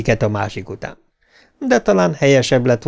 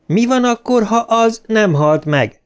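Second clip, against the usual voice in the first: high-pitched, insistent shouted question; shouting back; 9 semitones higher than usual. A narrator's reading. Bill objects to leaving with his friend, shouting back. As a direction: call the question back, pitch raised throughout. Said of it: "kiáltotta vissza Bill".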